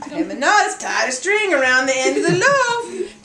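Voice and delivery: usual speaking voice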